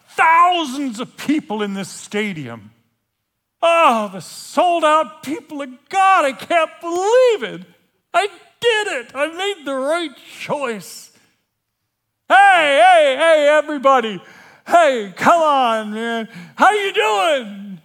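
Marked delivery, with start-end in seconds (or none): 3.6-11.1 high, loud shout of pure joy; a loud, high cry of triumph; elated shout. Acted. Bursting into crowded stadium. 12.3-16.3 loud, high greeting shouted across a stadium; hey everybody, yelled high and loud; calling out to crowd. Acted. 16.6-17.8 loud, high and slow, HOW leaning hard; slow, high, loud call with the weight on HOW; cheerful shout. Acted. Greeting huge crowd.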